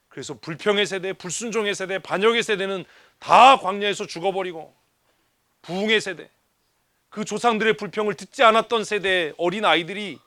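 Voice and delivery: ordinary delivery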